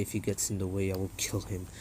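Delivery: low, soft, cold threat; Nagato's voice. Retold or imitated. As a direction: menace quietly from deep down. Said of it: "Nagato's like".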